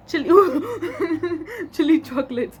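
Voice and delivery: laughing through the words; giggling. Real, not acted. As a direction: bursts of giggles on the name. Giggling over crush's nickname.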